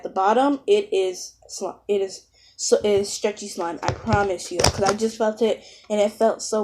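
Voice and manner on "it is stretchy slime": normal talking voice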